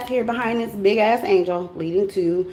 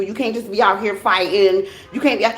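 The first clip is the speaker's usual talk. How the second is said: usual speaking voice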